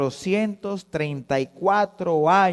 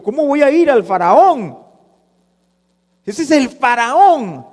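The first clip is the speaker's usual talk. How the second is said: loud, high acted objection; alarmed protest; 7 semitones higher, 8 dB louder than usual. Moses objects to facing Pharaoh, alarmed protest. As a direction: protest loudly, stretching the last word.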